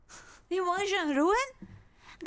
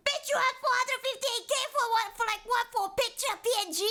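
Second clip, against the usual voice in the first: incredulous rant; 8 semitones higher, faster than usual. Real, not acted. Ranting at creator.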